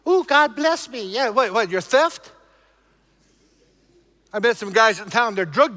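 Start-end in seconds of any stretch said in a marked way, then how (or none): 0.1-1.0 mock churchgoer. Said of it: "we play this little church game".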